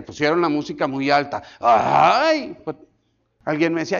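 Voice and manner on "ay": louder hammed-up yelp of hurt; overdone wounded cry; mock ouch. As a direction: pastor mocking offended members, louder yelp. Mocking hurt church members.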